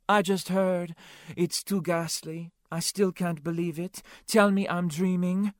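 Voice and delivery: flamboyant café owner. Acted. Claude on the phone hearing of the murder, high and dramatic. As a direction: gush over the news, all drama.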